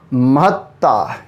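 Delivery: महत्ता said slowly, with a doubled t, ma-hat-taa; slow correct word. Shown as a demonstration. Teacher correcting students' word choice, slow emphatic pronunciation. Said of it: "महानता नहीं".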